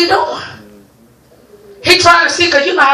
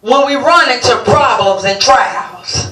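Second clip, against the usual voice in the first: scripture reading; 3 semitones lower, slower than usual. Acted. Preacher reads scripture slowly.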